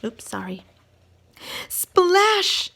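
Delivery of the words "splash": high, loud exclamation; big splash. Acted. Reader exclaims as George falls in the water, loud. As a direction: shout the splash with delight.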